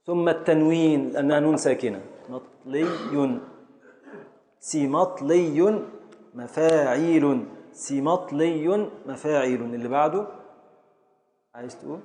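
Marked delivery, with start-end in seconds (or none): 2.3-3.4 مطلي drawn out slowly and softly; slow scansion. Shown as a demonstration. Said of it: "الطاء اول سكون". 4.6-9.6 سيمطلي set slowly against مفاعيل; match the word to its meter slowly; slow scansion. Shown as a demonstration. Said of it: "يبقى على وزن مفاعيل".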